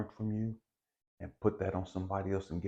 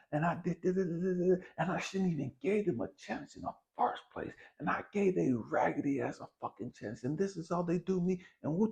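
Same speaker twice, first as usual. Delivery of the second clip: higher-pitched whiny venting about being wronged; aggrieved rant; 8 semitones higher than usual. Retold or imitated. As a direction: complain like you got played and it's unfair. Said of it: "me, I'ma flip it".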